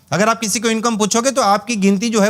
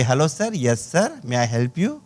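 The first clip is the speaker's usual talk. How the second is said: नीची, धीमी, नरम रिसेप्शनिस्ट की आवाज़; मेहमान का स्वागत करती विनम्र आवाज़ में, धीरे से; 8 semitones lower, 5 dB quieter, much slower than usual. Retold or imitated. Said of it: "आपको बोलेंगे". (होटल रिसेप्शन पर ग्राहक से, नरम विनम्र स्वागत)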